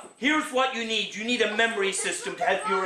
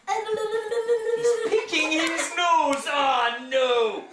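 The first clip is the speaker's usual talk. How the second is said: mock spooky reveal; 9 semitones higher, 3 dB louder, much slower than usual. Comic ghost-spotting bit to child.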